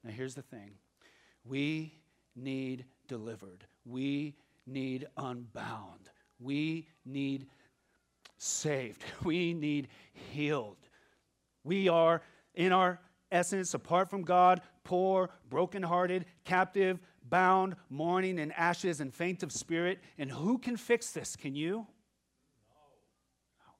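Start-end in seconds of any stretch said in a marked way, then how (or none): 1.4-10.8 slow, weighty stress on each need; slow earnest plea. Real, not acted. Preacher pressing the congregation's need, slow and earnest. 22.7-23.7 a breathless whispered no. Real, not acted. Preacher answering the congregation in a whisper. Answering his own question.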